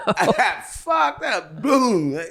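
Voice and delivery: high, loud laughter running through the words; laughing. Real, not acted. Telling the podcast host about the cop's clothesline, real laughter.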